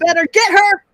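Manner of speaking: egging on. Retold or imitated. Friend cheering on a TV fight, high and loud. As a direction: urge her to go after her.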